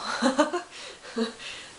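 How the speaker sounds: breathy, unvoiced chuckle; wry laugh. Real, not acted. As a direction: laugh it off after a pointed remark. Laughs at movie's casting.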